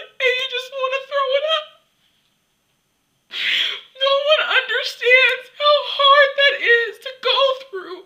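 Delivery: sobbing through the words, loud and high; choked up and wailing, voice pushed high; real crying. Real, not acted. Confessing an eating disorder to viewers, crying hard. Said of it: "Okay, I'm gonna cry".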